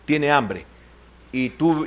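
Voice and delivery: normal talking voice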